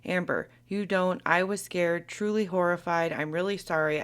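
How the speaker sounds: normal talking voice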